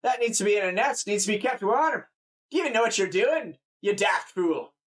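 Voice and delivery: high, fussing Scottish voice; Scottish farmer. Acted. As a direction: berate them as a Scottish countryman. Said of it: "like a Scottish person or whatever".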